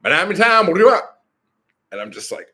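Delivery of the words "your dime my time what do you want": very high, loud, run-together mock holler; words mashed into one imitated Southern shout; garbled Louisiana phone greeting. Friend's dad answering a call, imitated unintelligible holler. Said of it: "But it came out".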